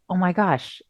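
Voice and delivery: normal talking voice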